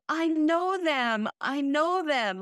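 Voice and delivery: put-on toddler's plea. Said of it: "And she said".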